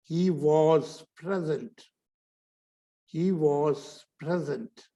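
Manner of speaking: slow 'he was present', WAS as rounded 'voz'; short 'was'. Shown as a demonstration. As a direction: model 'was' short after 'he', falling at the end. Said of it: "ये was मैंने छोटा बोला". (छोटा 'वॉज', धीमा नमूना)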